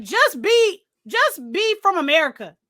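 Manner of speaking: high-pitched mock pleading; exasperated plea. Viewer begging the actor to drop the accent, mock exasperated. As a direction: plead with him in comic exasperation.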